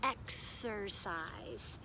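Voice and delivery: exercise drawn out slowly, each sound clear; slow spelled-out word. Shown as a demonstration. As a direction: stretch out exercise so kids hear the X. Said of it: "See the X in".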